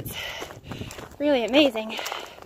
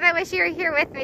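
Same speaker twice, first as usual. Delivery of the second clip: ordinary talk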